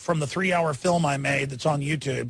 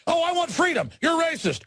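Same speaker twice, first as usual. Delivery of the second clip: much higher, mocking put-on voice; mock accusation; 13 semitones higher than usual. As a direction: act out the exchange in a high mock voice. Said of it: "they call you racist".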